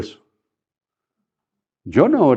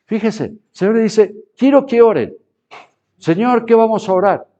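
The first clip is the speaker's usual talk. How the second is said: emphatic preaching; 8 semitones higher, 4 dB louder than usual. Acted. Preacher voicing Jesus and disciples.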